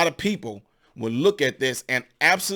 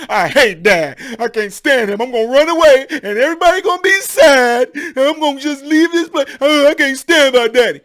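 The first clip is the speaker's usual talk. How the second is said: put-on a much higher, louder whiny child's tantrum; sulking kids; 9 semitones higher, 9 dB louder than usual. Sons in their room, wailing a high, loud childish tantrum. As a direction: wail like a sulking boy sent to his room. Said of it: "they went in their room".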